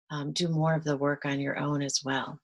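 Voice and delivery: ordinary talk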